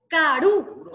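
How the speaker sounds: "Karú" with a strong last syllable; teacher demonstrating "Karú" stressed on the U; stress on final U. Shown as a demonstration. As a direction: punch the ú at the end of "Karú". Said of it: "Dónde suena más fuerte?".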